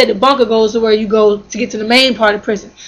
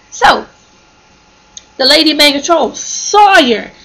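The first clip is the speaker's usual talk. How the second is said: ordinary delivery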